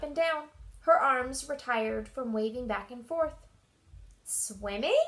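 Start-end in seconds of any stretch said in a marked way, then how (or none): 4.2-5.0 mildly hopeful question, rising at the end; hopeful squeak. A narrator's reading. Mouse guessing Mole's surprise, mildly hopeful. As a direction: guess with a touch of hope. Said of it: "she squeaked hopefully".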